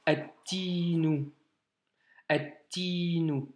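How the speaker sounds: "attinu" slow, the T doubled and no L heard; slow teaching recitation; lam dropped. Shown as a demonstration. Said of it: "On n'entend pas le lam".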